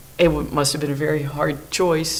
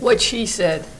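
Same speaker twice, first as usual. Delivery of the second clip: ordinary talk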